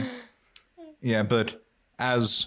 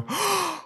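sharp high gasp of fake shock; a high, theatrical gasp; mock shocked gasp. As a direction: gasp in hammed-up horror. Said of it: "Jimmy and Kimmy both go".

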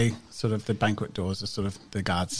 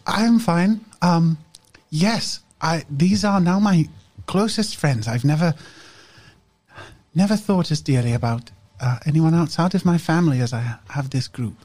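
higher, louder, insistent character voice; earnest young friend; 7 semitones higher, 6 dB louder than usual. Acted. Game master as Medela reassuring the group, earnest and higher. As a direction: speak up in a heartfelt, lifted voice.

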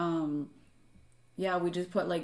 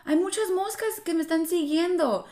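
mock child's voice, pitched high; frightened little girl; 9 semitones higher, 4 dB louder than usual. Mother imitates her daughter complaining about bugs, high child voice. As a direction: act out a scared kid running up to mum. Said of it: "Olivia starts going".

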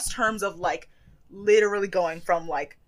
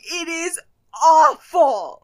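horrified; 7 semitones higher, 7 dB louder, much slower than usual. Real, not acted. Horrified at haircut.

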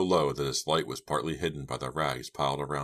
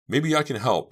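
eager; 10 semitones higher, 7 dB louder than usual. A narrator's reading. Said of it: "Dina told him".

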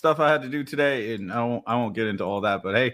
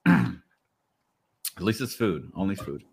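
mouth full; 3 semitones lower, slower than usual. Real, not acted. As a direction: talk around a bite of food. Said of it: "food in your mouth".

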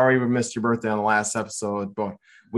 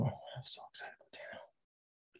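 whispering; 22 dB quieter than usual. Real, not acted. Whispered aside about a player.

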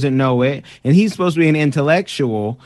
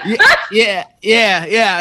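laughing along; 6 semitones higher, 4 dB louder, slower than usual. Real, not acted.

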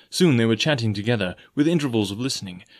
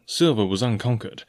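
ordinary delivery

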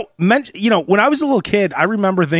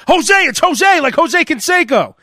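put-on eager kid answer; 9 semitones higher, 4 dB louder than usual. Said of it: "I'm like".

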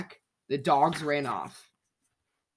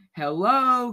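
high loud hello that fades like an echo; distant cave echo; 7 semitones higher, 5 dB louder than usual. A narrator's reading. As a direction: give the echo back. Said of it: "came an echo".

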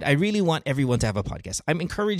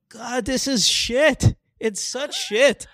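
disgusted; 13 semitones higher, 4 dB louder, much slower than usual. Retold or imitated. Disgusted quote of podcasts.

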